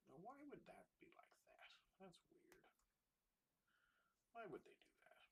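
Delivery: puzzled whisper to herself. Real, not acted. Stuck on a strange screen result, whispering to herself. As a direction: breathe the question out under her breath.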